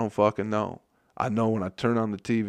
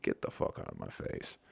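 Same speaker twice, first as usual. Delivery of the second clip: muttered retort; 13 dB quieter than usual. Retold or imitated. Retold snap at heckling lady.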